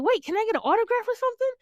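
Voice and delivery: put-on high, fast starstruck kid voice; excited kid. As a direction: blurt it eagerly like a young fan. Acting out excited kid asking autograph.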